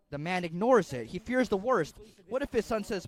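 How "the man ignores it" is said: usual speaking voice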